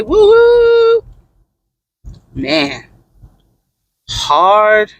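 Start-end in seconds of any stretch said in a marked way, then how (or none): 0.0-1.0 high squealed woo-hoo; a jump in pitch, celebrating Friday; cheering that the week is over; excited cheer. Real, not acted.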